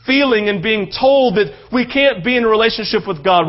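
ordinary talk, nothing marked

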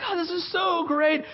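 high-pitched delighted exclamation; filled with joy. Retold or imitated. Preacher reliving his dream joy, elated. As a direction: gush it, pitch soaring. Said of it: "I'm filled with joy".

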